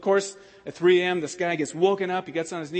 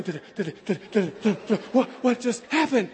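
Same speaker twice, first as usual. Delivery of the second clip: pounding heartbeat; 2 semitones higher than usual. Retold or imitated. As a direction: mimic your heart pounding. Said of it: "my heart's just going".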